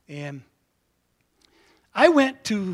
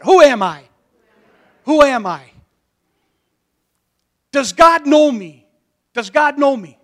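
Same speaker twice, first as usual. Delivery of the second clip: loud call; 5 semitones higher, 11 dB louder than usual. Shown as a demonstration. Preacher prompting congregation to repeat, loud calls. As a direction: boom each question for the crowd to echo. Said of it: "Say it".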